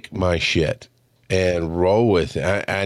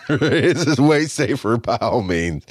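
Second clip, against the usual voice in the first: talking through a chuckle, slower; real amused laugh running into the words; 2 semitones higher, slower than usual. Real, not acted. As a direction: laugh while admitting it. Laughs at own admission.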